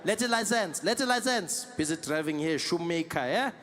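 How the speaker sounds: put-on animated South African accent haggling; South African policeman. Roadside haggling with Metro Police, South African accent. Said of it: "immediately you're in the game".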